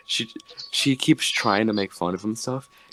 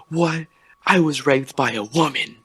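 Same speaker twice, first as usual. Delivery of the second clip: higher, louder mock indignant outburst; mock outrage; 8 semitones higher, 6 dB louder than usual. Comedian hits back at heckler, loud mock outrage. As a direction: blurt it in fake shock. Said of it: "but he goes".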